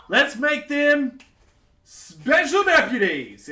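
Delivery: high, loud and slow royal proclamation; a giddy king booming his decree; excited king. Acted. King naming the party his deputies, booming high.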